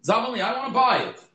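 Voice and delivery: eager Rav Yanai. Retold or imitated. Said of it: "so he says to this Reichel".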